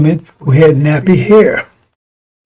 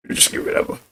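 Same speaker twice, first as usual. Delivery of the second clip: much softer, breathy whispered echo; whispered repeat; 10 dB quieter, faster than usual. Shown as a demonstration. Repeating the point about roaches to listeners, whispered. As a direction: drop it to a whisper. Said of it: "repeat dropped to a hush".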